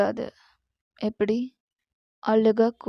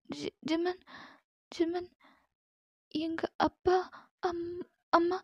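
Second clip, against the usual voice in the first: high, soft, trembling plea to let her go; frightened girl pleading; 8 semitones higher, 10 dB quieter than usual. Acted. Captive girl begs Jimman to send her home, frightened pleading. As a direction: beg your captor like a scared young girl.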